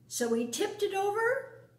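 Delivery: usual speaking voice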